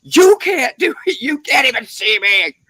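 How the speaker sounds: high, loud mock yelling. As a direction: yell it up high at a blind person, hammed up. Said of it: "You're like".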